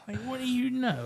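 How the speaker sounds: high, mock-indignant question. Mocking his own argument with God, high and indignant. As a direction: play up the sassy pushback.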